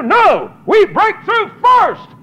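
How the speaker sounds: high, slow, loud preaching shout; rising shout. Real, not acted. Preacher urging the church on, loud and high. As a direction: drive it home with fire.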